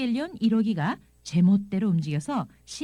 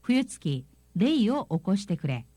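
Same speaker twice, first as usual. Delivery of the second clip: each syllable read out very slowly; slow dictation-style Japanese; slow careful reading; much slower than usual. Shown as a demonstration. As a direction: spell the line out slowly for learners. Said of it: "아버지가".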